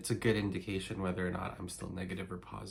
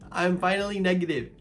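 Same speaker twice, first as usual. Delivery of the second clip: higher, louder with joy; excited; 8 semitones higher, 8 dB louder than usual. Real, not acted. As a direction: celebrate finally. Happy repetition.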